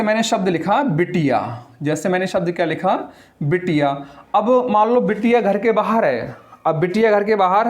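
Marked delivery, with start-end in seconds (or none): none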